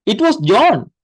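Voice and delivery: It was John, with John lifted high and pushed; stress on John. Shown as a demonstration. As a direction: land the weight on John. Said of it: "So emphasis on the subject".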